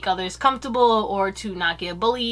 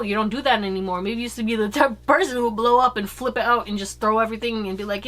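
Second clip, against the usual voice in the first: ordinary talk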